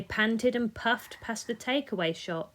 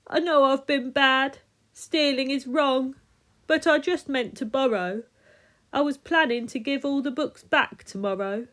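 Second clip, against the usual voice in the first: remorseful thief; 6 semitones higher, 4 dB louder than usual. Acted. Thief apologises, sniffling.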